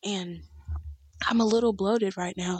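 ordinary talk

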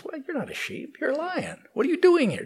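high-pitched, disbelieving challenge; high, astonished storybook lion; incredulous big lion. Acted.

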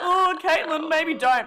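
high, loud imitating pleading; a mock chorus begging her to stop. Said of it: "everyone else was like".

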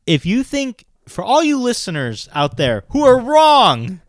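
high, slow, loud call-out; calling out listeners. Real, not acted. Scolding podcast listeners who disagree, high and loud. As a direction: address the whole audience.

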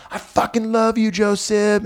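high-pitched mock yell, not full volume; retold fan yell. Young kid up front yells to the comic, mock holler. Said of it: "He just yells out".

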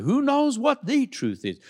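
a lightly mocking skeptic asking "Who knows what the truth is?"; mock people's voice.

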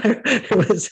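high laugh breaking into the words; burst of laughter. Real, not acted. Preacher laughing at his friend's pool ordeal, high laugh.

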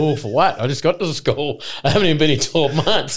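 retold schoolboy protest. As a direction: protest like a baffled kid called out. Retelling his schoolboy protest to mates.

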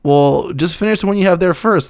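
ordinary talk, nothing marked

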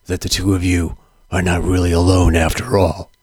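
low gravelly dramatic narrator; deep, brooding movie-narrator rasp; Mickey Rourke gravelly voice. Retold or imitated. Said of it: "Mickey Rourke's gravelly voice".

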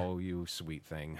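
low, soft, slow fond pity; mock tenderness. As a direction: coo it slowly and softly, teasing. Retold tender reply to wife.

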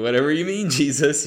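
high, loud laughing brush-off; laughing dismissal. Retold or imitated. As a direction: laugh it off, mocking the idea. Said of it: "they're probably like".

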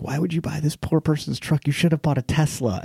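a light mocking impression of a snob; mocking Michaela. Mocking girlfriend's snobby complaint.

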